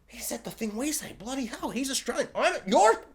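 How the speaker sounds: hushed, high-pitched excited aside; cheeky aside. Retold or imitated. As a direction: re-enact excitedly confiding in your cinema companion, very softly. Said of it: "cheeky look to the person I was watching it with".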